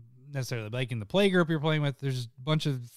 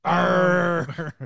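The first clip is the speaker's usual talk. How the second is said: pirate voice; 3 semitones higher, 5 dB louder than usual. Pretend. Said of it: "Why'd you become a pirate?".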